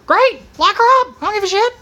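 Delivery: rising to a full exasperated shout; heated retort. Real, not acted. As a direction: shout down the whataboutism. Mock-agreeing shout at critics.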